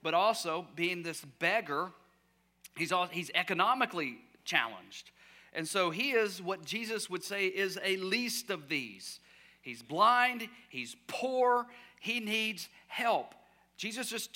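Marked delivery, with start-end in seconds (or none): none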